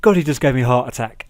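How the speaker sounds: loud hammed-up gasp of relief; loud mock exasperated relief. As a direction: overplay the fright and relief. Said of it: "Punk just goes".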